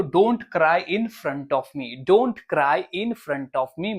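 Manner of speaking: very slow, every word spaced; slow model sentence. Shown as a demonstration. Teacher slowly modelling the full sentence for students. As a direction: drill it slowly for the class. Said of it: "teacher models slow sentence".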